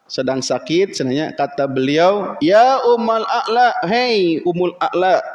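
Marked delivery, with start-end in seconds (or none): 2.4-5.2 slow, drawn-out hail by name; calling out. Acted. Preacher voicing a call to a sick woman, hailing her.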